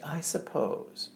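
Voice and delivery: slow, soft, grudging I suppose; mother's grudging answer. Retold or imitated. Daughter imitating her mother's grudging reply, slow and soft. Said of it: "That's how my mother answers things".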